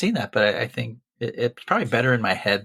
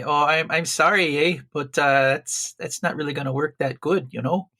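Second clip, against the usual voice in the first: put-on apologetic Canadian accent, slightly raised; 3 semitones higher than usual. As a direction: put on a polite Canadian "sorry, eh". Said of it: "That was really good Canadian accent".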